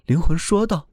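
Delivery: usual speaking voice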